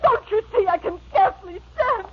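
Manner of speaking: high, crying, near hysteria; tearful. Acted. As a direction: sob out the plea.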